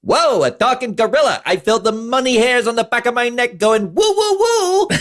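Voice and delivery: put-on high, loud New York accent; hammed-up New York wise guy. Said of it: "And he goes".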